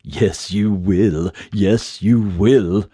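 eerie, drawn-out tease; lightly voiced haunting tease; teasing voice. A narrator's reading. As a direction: taunt slyly as the mysterious voice. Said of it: "the voice teased".

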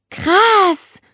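put-on high, drawn-out girl's groan of wonder; a girl sighing in amazement. A narrator's reading. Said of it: "stöhnte Nele".

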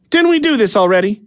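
slightly impatient housewife; a touch annoyed. Acted. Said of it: "sounding a touch annoyed".

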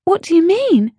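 put-on high, small nervous child question; a bit nervous. A narrator's reading. Ellie unsure what the rabbit means, a touch nervous. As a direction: ask it timidly. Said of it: "Ellie asked a bit nervously".

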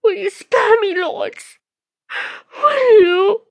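very high, pleading woman's voice; desperate begging. Acted. Kneeling woman pleading with the lords to spare her, desperate begging. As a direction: beg for mercy in a high wail.

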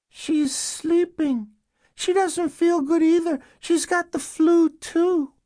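put-on very high, slow little-girl voice; young girl. Girl answering caseworkers through the door, young child voice. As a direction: answer in a tiny child's pitch, drawn out.

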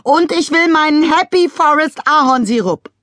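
high, loud whiny demand; demanding shout. A narrator's reading. Wife demanding maple syrup, shouting. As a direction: insist loudly on the syrup.

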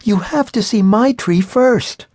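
put-on high, bright-eyed child's voice; a young girl pushing her family to see her tree; eager little girl. A narrator's reading. Little sister begging her family, high and eager.

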